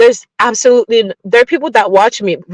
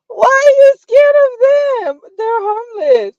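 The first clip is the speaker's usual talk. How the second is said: high teasing voice; 12 semitones higher than usual. Real, not acted. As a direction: tease them in a high voice.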